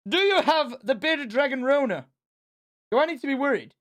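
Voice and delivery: high-pitched, playful worry; squeaky concern, half joking; mock alarm. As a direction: question the pet in a high, worried voice. Mock worry over coughing pet.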